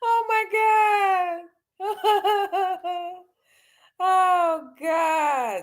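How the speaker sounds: wheezing through laughter; can barely get it out for laughing. Real, not acted. Cracking up at own joke.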